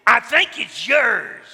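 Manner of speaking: slow, high, very loud retort; accusing shout. Retold or imitated. Man in the audience answering the angry speaker, accusing shout. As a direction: yell the comeback across the room, hammed-up.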